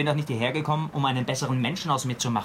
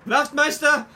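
much higher, loud, drawn-out cry for help; panicked shout; 13 semitones higher, 6 dB louder, slower than usual. Acted. Young man panics and shouts for the sergeant, high drawn-out yell.

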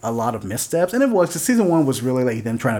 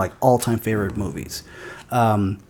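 ordinary talk

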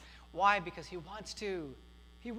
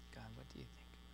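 a much softer, lower, whispered prayer to God; hushed prayer; 6 semitones lower, 16 dB quieter, much faster than usual. Shown as a demonstration. Pastor leading the congregation to pray the question to God, hushed. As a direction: pray the question quietly, almost to yourself. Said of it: "I want you to ask God".

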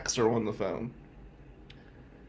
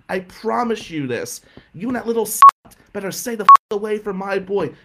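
raised, higher-pitched angry threat; screaming aunt; 6 semitones higher, 7 dB louder than usual. Retold or imitated. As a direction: imitate an aunt yelling threats down the phone. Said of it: "screams at him".

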